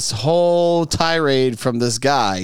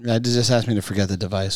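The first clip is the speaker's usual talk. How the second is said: usual speaking voice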